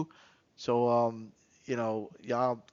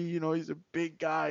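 ordinary delivery

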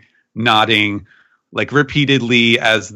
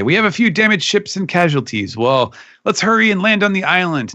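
urgent report; 10 semitones higher than usual. Retold or imitated. Samurai voiced reporting after battle.

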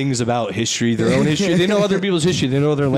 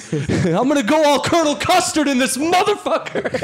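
hammed-up threat; 12 semitones higher than usual. Voicing the kid's threat.

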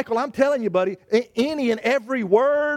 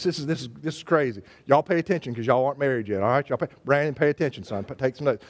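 quick, lower-pitched aside; rapid low aside; 10 semitones lower, faster than usual. Real, not acted. Preacher quickly warning the unmarried young people, low and fast. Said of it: "aside to audience".

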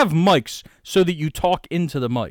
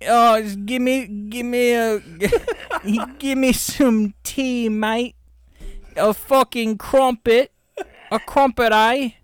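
mock British accent; 8 semitones higher than usual. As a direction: ham up a Cockney tea-and-crumpets Brit. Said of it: "Robert Pattinson".

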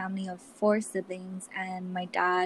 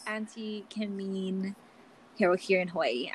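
ordinary talk

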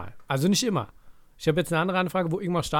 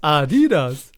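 loud Adidas, both A and DI stretched; exaggerated stress on Adidas; 9 dB louder, much slower than usual. Shown as a demonstration. Calling back the pronunciation joke, loud overstressed Adidas. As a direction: punch out Adidas with an overdone middle DI.

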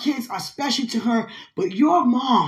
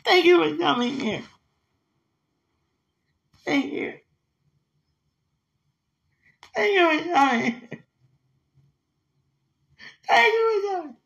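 high-pitched real crying; high, wailing, overcome with emotion; tearful gratitude; 7 semitones higher than usual. Real, not acted. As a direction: sob out heartfelt thanks. Overcome, thanking the visiting spirit.

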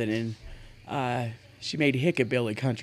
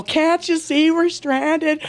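high, loud, drawn-out plea; mock pleading shout; 12 semitones higher, 6 dB louder, much slower than usual. Daughter knocks on drivers' windows, high mock-desperate calling out. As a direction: imitate a kid calling to strangers in cars.

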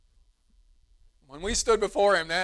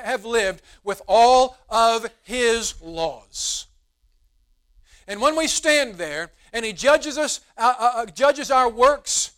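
preaching; 3 semitones higher, 6 dB louder than usual. Acted. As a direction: drive each phrase home to the church. Preaching climax of sermon.

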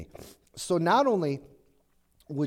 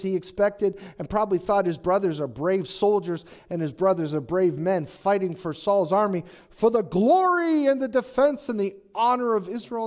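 ordinary talk, nothing marked

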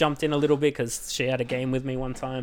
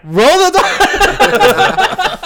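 very high, loud and drawn out; exasperated repeat; 12 dB louder, much slower than usual. Retold or imitated. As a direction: drag the words out, fed up. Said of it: "I'm like".

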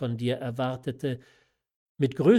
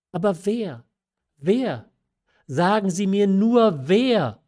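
higher, louder, insistent pleading; impatient, rising plea to be told; urgent demand; 6 semitones higher, 7 dB louder than usual. A narrator's reading. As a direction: press hard for the name, building in force. Marquise presses again for the name.

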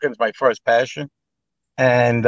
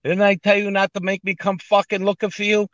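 mock mobster threat; 8 semitones higher than usual. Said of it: "I go".

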